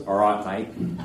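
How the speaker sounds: low, hammed-up Australian accent; Australian woman played as a deep-voiced bloke; Steve Irwin. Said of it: "My only experience with the Australian accent is Steve Irwin".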